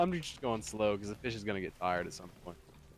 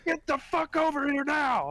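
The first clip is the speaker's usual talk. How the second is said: high, loud cop command; cop shouting; 13 semitones higher, 4 dB louder than usual. Retold or imitated. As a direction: bark it across the lake at the poacher. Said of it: "He looks at me, he's like".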